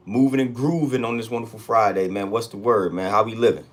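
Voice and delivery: usual speaking voice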